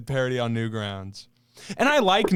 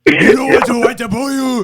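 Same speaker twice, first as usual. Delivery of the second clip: much higher and louder hammed-up impression; exaggerated shouted Miyamoto bit; loud mock Miyamoto; 8 semitones higher, 9 dB louder than usual. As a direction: blast the quote out over the top. Hamming up the Miyamoto quote.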